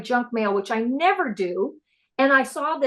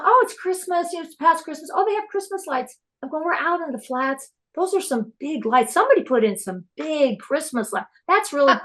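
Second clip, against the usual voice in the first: high, bright wondering about Christmas lights; retelling her own amazed reaction; excited realization; 6 semitones higher than usual. Retold or imitated. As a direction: replay the excitement of spotting the lights.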